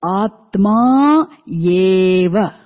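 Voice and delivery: Atma eva stretched very slow, weight on the phrase; stressed eva. Shown as a demonstration. Teacher stressing the emphatic word eva for the class. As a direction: draw out Atma eva slowly, pressing it. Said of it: "Eva means only. The emphatic".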